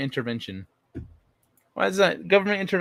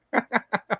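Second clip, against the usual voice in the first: high burst of laughter; laughing; 9 semitones higher than usual. Real, not acted. Laughs at own story detail.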